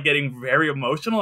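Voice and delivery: ordinary talk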